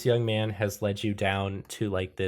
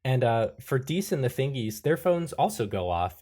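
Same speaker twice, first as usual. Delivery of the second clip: usual speaking voice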